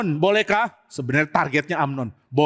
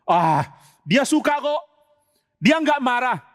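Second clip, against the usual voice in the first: higher, slow careless brush-off; slurring callous shrug; drunken dismissal; 6 semitones higher, slower than usual. Acted. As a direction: wave it away like a drunk who doesn't care. Drunk Amnon shrugs off guilt.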